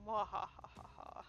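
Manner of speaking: low, quiet villain cackle; sorceress evil laugh. Acted. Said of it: "standing back going".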